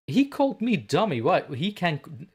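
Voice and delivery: high, loud mock-outraged protest; mock indignation. Streamer protesting to co-host over name-calling, mock indignant. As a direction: protest the unfairness, pitch jumping up. Playful protest at being told off.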